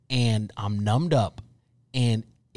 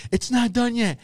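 much higher voice calling back; high-pitched retold answer; imitated wife; 12 semitones higher, 3 dB louder than usual. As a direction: mimic a harried wife's reply.

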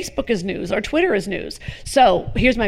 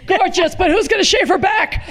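raspy-free high brassy comic jab, pitched up; Joan Rivers; 8 semitones higher than usual. Retold or imitated. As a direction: do a sharp, high old-school insult comic. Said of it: "that joke Joan would do".

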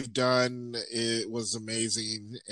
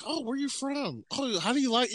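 high, eager questions to a newcomer; excited; 12 semitones higher than usual. Retold or imitated. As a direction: sound thrilled to meet someone from up north. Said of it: "I'll be excited".

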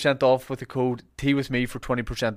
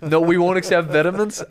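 mock American voice through a laugh; laughing fake American accent; 6 semitones higher, 7 dB louder than usual. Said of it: "you gotta say it in an American accent".

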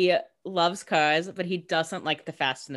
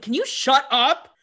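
retold outburst, louder, higher and drawn out; slow, put-on "shut up" in a raised voice; mock exasperation; 8 semitones higher, 5 dB louder, slower than usual. [name] to Caroline about the car, mock exasperated.

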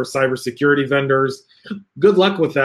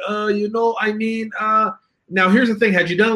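put-on higher, halting Hebrew-accented vendor filler; 9 semitones higher than usual. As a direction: stumble for English words with a Hebrew accent. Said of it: "And they're breaking English".

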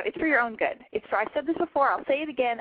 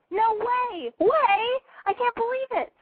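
squealed, much higher, hammed-up disbelief; mock astonishment; 12 semitones higher, slower than usual. Host teasing a caller touching himself, squealing mock shock. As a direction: gasp it out like a scandalized teen.

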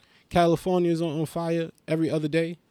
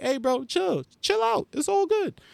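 much higher, tense soothing voice; high nervous placating. Retold or imitated. Comedian nervously calming the angry stranger, high and placating. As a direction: talk him down in a high, nervous pitch. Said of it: "I'm just like".

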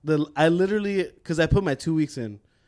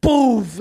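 loud, drawn-out "boof" impact noise; imitated crash; 7 semitones higher, 6 dB louder than usual. Describing the runaway car hitting bins, imitated crash. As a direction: puff out the thud of a crash.

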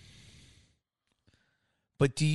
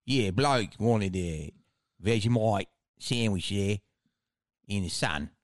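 stretched Australian. Shown as a demonstration. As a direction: stretch it out like a bogan. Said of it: "but stretch it out".

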